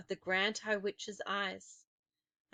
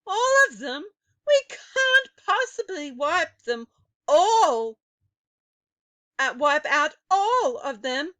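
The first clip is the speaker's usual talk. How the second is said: high, louder frightened protest; alarmed witch; 7 semitones higher, 12 dB louder than usual. A narrator's reading. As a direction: blurt out your doubt in alarm. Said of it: "saying aloud".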